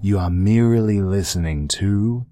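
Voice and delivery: low, slow sultry murmur; sexy voice. Acted. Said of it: "I'm doing, like, a sexy thing".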